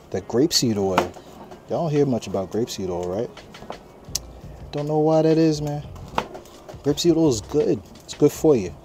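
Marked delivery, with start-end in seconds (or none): 4.7-8.7 put-on higher-pitched, playful New York accent. Cook telling viewers about grapeseed oil, light New York accent. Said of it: "Why you talking in a New York accent?".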